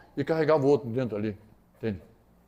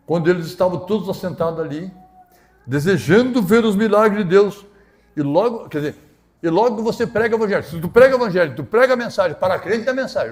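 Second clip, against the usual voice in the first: loud, raised-pitch preacher's delivery; impassioned preaching; 5 semitones higher, 9 dB louder than usual. Real, not acted. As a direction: preach it out forcefully, punching the key words. Preaches at full force.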